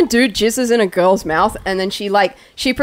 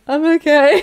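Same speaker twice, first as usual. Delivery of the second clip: higher, put-on feeble reassurance; mock queasy voice with a laugh in it; imitating sick friend; 4 semitones higher than usual. Friend imitating drunk man answering through the door, mock feeble. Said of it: "And he's like".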